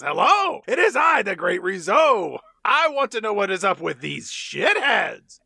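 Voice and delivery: hammed-up grand villain announcement, high and loud; swaggering up to the thugs, booming and grandiose; Rizzo the Great. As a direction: announce yourself like a pompous cartoon baddie. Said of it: "Rizzo walks over and he says".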